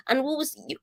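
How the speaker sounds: ordinary delivery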